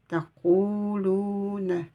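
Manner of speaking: taquluna chanted, drawn out; slow Quranic recitation. Shown as a demonstration. Teacher to students, chanting the word slowly. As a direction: hold every syllable of taquluna. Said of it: "second reading of the word".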